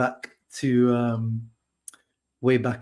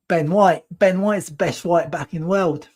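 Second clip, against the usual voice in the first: mock Cockney fan accent; 5 semitones higher than usual. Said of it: "My accent sketches are great".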